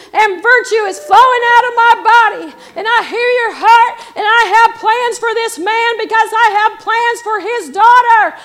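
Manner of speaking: loud, high preacher voicing the Almighty; God's proclamation. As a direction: proclaim each promise at full preaching force. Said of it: "that God reached down and said".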